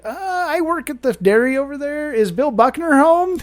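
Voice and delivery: high, nervous teenage squeak, hammed up; shy, stammering. Shy boy asks cute daughter for her dad, nervous and high. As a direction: imitate a bashful kid faltering through a question. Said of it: "stammering. I was so, like, shy".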